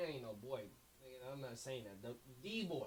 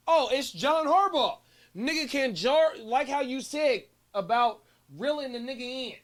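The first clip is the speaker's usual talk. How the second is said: excited; 4 semitones higher, 10 dB louder than usual. Real, not acted. Excited sudden idea.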